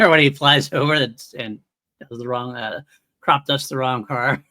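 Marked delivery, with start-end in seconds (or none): none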